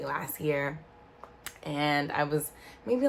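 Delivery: normal talking voice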